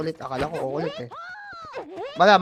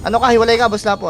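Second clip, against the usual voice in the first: louder, sharp warning call; 4 semitones higher, 9 dB louder, faster than usual. Real, not acted. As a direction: warn them loudly. Warning teammate mid fight.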